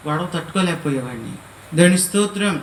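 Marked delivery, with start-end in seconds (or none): 1.8-2.6 loud preacher's praise; loud praise exclamation. Real, not acted. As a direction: Praise God called out loud to the hall.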